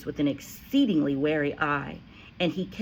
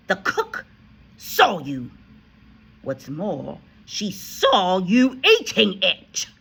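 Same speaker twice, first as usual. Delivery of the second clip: loud, slower accusing; angry accusation; 4 semitones higher, 8 dB louder, slower than usual. A narrator's reading. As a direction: hammer the proof home. Headmistress continues accusing.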